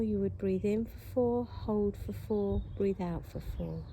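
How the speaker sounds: ordinary talk, nothing marked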